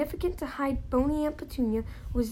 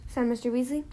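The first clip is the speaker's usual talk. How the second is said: usual speaking voice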